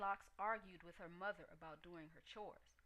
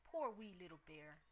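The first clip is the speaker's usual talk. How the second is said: usual speaking voice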